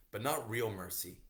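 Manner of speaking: usual speaking voice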